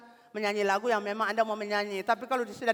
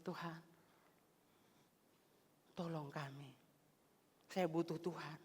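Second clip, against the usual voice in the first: much softer, slow pleading to God; hushed prayer; 4 semitones lower, 13 dB quieter than usual. Acted. David crying to God for help, hushed and pleading. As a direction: pray it almost under the breath. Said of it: "Dia berseru".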